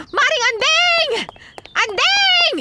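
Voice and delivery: loud, high call across the street. Acted. Gossip hailing Maring Anding from afar, calling loud. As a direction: shout her name to get attention.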